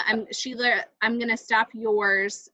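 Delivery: normal talking voice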